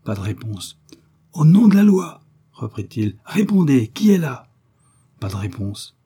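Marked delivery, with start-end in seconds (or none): 1.3-2.2 loud, high official summons; shouted command. A narrator's reading. Commissioner summoning the hidden intruder, shouting. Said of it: "le commissaire cria". 3.2-4.5 high, loud insistent challenge; shouted demand. A narrator's reading. Commissioner insisting the intruder answer, shouting. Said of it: "le commissaire cria".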